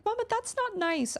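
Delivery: put-on very high little-kid protest; imitating young daughter. Toddler scolding her mama, imitated high child voice. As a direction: mimic a child's high-pitched complaint. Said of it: "when she was like".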